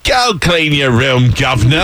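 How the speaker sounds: put-on slow, stretched Cockney-Australian hybrid accent; hammed-up Cockney order; mock Cockney. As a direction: drag it out in bad Cockney. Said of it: "It's only cockney 'cause I said governor".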